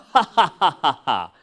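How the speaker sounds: hammed-up "ha ha ha" laugh; staged sarcastic laughter; mock laugh. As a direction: spell out a fake laugh. Mock laughter at own joke.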